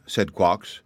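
usual speaking voice

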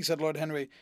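plain reading voice

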